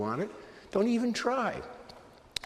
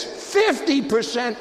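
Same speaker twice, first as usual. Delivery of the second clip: slow, high, loud mock disbelief; shocked echo; 9 semitones higher, 7 dB louder, much slower than usual. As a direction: stretch it out in outrage. Salesman shocked at offer.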